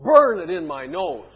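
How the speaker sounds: loud, hammed-up fake delight; mocking God's delight. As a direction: gush sarcastically, booming the opening words. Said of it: "you're making a mockery of this".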